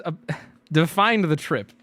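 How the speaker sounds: ordinary talk, nothing marked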